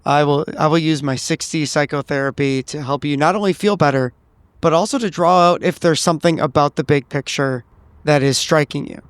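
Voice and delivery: normal talking voice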